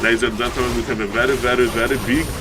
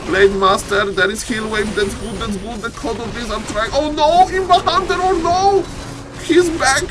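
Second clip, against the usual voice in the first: frantic play-by-play; 11 semitones higher than usual. Real, not acted. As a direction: shout the rescue as it happens. Frantic fight, hero survives.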